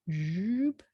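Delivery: soft rising "zoop" sound effect; drawn-out zoop. Real, not acted. Teacher drawing a straight line down, zippy sound effect. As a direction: voice the pen stroke as it slides.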